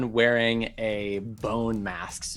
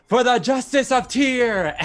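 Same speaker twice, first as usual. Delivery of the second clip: high, booming battle cry for justice; loud declaration; 12 semitones higher, 7 dB louder than usual. Acted. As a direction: declare it loudly like a righteous paladin. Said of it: "then he declares loudly".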